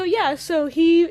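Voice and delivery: ordinary talk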